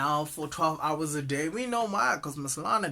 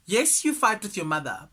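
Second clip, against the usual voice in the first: normal talking voice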